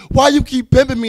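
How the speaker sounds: loud accusing cry at him; loud retold shout; loud confrontation. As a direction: call him out loud, raw. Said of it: "And I said it louder".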